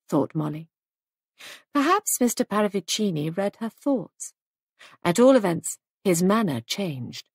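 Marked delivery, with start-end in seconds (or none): none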